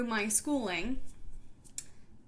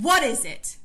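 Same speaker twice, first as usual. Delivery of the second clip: louder, harsher demand in a changed voice; frustrated teacher; 3 semitones higher, 8 dB louder than usual. Retold or imitated. Teacher looming over the desk, loud impatient demand. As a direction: bark the question down at the child. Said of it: "She leaned over and said".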